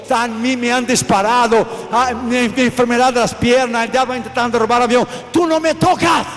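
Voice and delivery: loud, forceful testimony; defiant preaching. Real, not acted. Preacher answering a satanist's threat, loud defiant proclamation. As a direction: proclaim it at full volume, defying the enemy.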